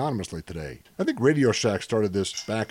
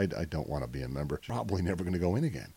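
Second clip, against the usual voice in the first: quiet mumble; 6 semitones lower, 8 dB quieter, faster than usual. Acted. Quiet flustered refusal.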